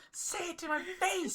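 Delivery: high, slow mock wail; crying kid imitation. Mocking the crying kid's challenge, high drawn-out wail. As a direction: squeal it like an upset kid. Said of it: "then he started crying".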